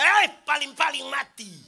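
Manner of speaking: loud careless retort; defiant shout. Retold or imitated. Drunk husband shouting back at his wife, loud. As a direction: shout it back, don't care. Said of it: "Dia bilang".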